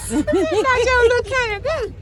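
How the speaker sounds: high, slow and laughing; drawn out through a laugh. Real, not acted. Craving fair food, laughing.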